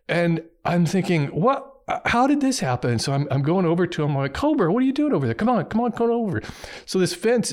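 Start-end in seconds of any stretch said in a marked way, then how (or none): 1.3-3.0 high-pitched, incredulous retold thought; baffled disbelief. Retold baffled thought on seeing cat. 4.3-6.4 high, quick call to a pet; urgent coaxing. Retold or imitated. Retold calling the cat over.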